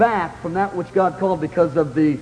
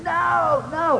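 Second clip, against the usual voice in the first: retold scream; 11 semitones higher than usual. Preacher re-enacting his dread of the calling, mock screaming. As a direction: wail two long high noes. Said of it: "I was screaming".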